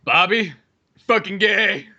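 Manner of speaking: mock disgusted dad, pitched a bit up; a hint of a scornful father; lightly voiced beer-drinking father. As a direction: play a drunk dad heckling his son. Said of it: "a father that was just always sitting there drinking beer".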